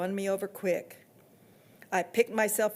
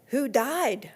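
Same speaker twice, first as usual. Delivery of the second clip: put-on girl asking; 5 semitones higher than usual. A narrator's reading. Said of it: "I asked".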